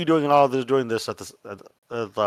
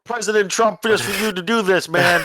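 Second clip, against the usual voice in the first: high, whining complaint; a high indignant reporter; whiny reporter; 7 semitones higher, 6 dB louder than usual. Acted. GM voices the journalist protesting.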